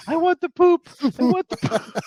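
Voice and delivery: put-on high, loud silly kid voice; giddy little kid. Dad imitating his son demanding the poo-poo platter, high giddy kid voice. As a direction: squeal it like a giggling little boy. Son laughing at menu name.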